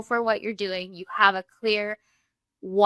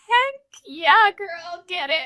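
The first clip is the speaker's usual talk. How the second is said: mock cheer; 9 semitones higher, slower than usual. Cheering friend on.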